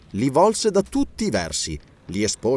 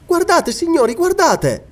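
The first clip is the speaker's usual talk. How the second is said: very high, louder, urgent call; lady's high, insistent cry; excited exclamation; 5 dB louder than usual. A narrator's reading. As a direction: call everyone over to see, pitched high. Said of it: "esclamò Elena".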